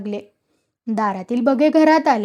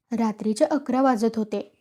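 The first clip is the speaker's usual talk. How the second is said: plain reading voice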